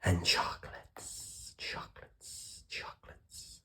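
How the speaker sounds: faint whispered echo; echo from the cave. Acted. Cave echo answering the duck, whispered and fading. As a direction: fade the word away like a cave echo. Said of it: "the voice from the cave replied".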